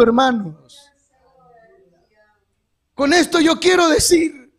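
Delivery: usual speaking voice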